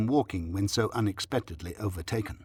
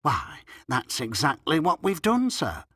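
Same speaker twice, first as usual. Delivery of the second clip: higher, rising delighted voice; delighted landlady; 4 semitones higher than usual. A narrator's reading. Landlady answering the doctor, delighted. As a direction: pipe up, pleased to agree with the doctor. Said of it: "said the delighted landlady".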